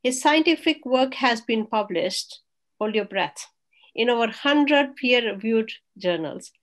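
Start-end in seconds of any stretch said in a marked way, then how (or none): none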